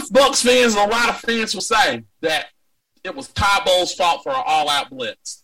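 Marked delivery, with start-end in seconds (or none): none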